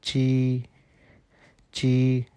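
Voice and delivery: ची said as a light unaspirated chi; tongue flat, short ची; ची, the Chinese j sound. Shown as a demonstration. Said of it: "इसको हम बोलते हैं".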